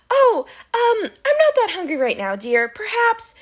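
high falsetto, hesitant bride act; fake bride. A narrator's reading. Grover posing as the Cyclops' bride, nervous falsetto. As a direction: stall sweetly in a high put-on lady's voice.

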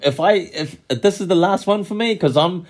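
high, animated retelling of Chance; [name] impression. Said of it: "and he's like".

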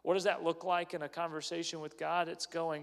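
ordinary delivery

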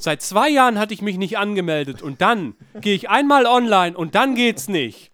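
loud, high mock rant about the server; hammed-up fury, voice way up; mock outrage. Friend ranting in jest about failed login, loud mock outrage. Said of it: "Ich hab mich so geärgert".

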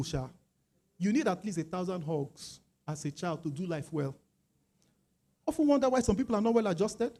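ordinary talk, nothing marked

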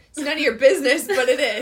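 sudden loud mock-aggressive snap. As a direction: bark it out in jest. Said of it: "Why did you get so aggressive?".

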